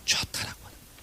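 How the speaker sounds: breathy whispered answer; hushed aside to the congregation. Real, not acted. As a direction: whisper it like a secret.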